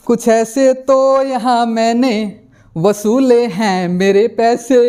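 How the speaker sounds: quick, lilting recital of a light Hindi poem with laughs; fast, playful Hindi poetry reading with a grin. Shown as a demonstration. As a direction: recite the comic verses briskly and cheerfully. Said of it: "as a poem ... in Hindi".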